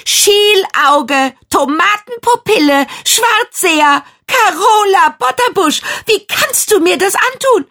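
furious ranting. A narrator's reading. Girl hurls insults at mirror.

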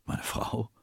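hushed, almost voiceless question; stunned disbelief. A narrator's reading. Captain hears his wife is missing, hushed and stunned. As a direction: echo it softly, barely believing it.

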